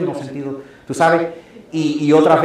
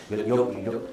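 ordinary talk, nothing marked

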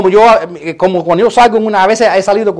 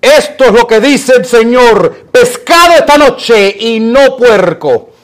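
slow, loud proclamation of a trivial order; mock divine decree; 3 semitones higher, 4 dB louder, slower than usual. Believer passing off a dinner choice as God's word, mock decree. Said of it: "tener que constantemente decir".

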